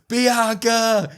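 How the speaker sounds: high loud mock scream of a name; retelling a wrestler screaming as she falls, high and loud. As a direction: yell the name as you're thrown. Naomi screams as she's slammed.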